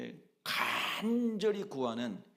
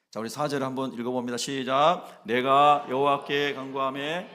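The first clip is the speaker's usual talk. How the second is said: calling the reading; 2 semitones higher, 10 dB louder than usual. Real, not acted. Leads reading aloud.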